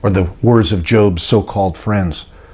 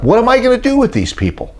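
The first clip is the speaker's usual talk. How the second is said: 1 exasperated Moses; 13 semitones higher, faster than usual. Retold or imitated. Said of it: "Moses said to God".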